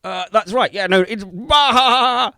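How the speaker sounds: high, loud flustered babble, stretched out at the end; mock a streamer scrambling for the keyboard; flustered streamer impression. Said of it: "you're suddenly like".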